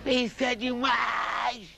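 high, slow, drawn-out cry of delight; man hearing a winning samba cries out, high and slow; shouted exclamation. Retold or imitated. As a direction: belt it out, high and stretched, like a thrilled fan at the samba contest.

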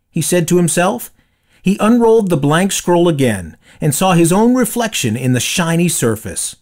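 ordinary delivery, nothing marked

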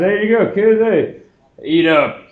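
a high, overly sweet dad offering treats; mock cheerful dad. Dad hands kids tainted cookies, mock cheerful. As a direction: sell the cookies like a too-eager dad. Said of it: "dad serving the cookies".

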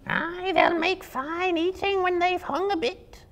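a greedy spider gloating over its catch. Acted. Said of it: "said another".

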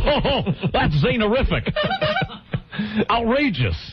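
words squeezed out through real laughter; laughing. Real, not acted. Host laughing at a sitcom premise, cracking up.

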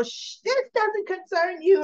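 high-pitched, dismissive hush; imitated haughty brush-off; mock shushing. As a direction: shut down someone butting in, playfully acted. Tracy shushes Olivia in retold quarrel.

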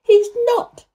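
very high, loud outburst; indignant near-shout. A narrator's reading. Girl shouting up the wall in his defence, indignant. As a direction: cry out indignantly for someone else. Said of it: "almost shouting up the wall in her first indignation".

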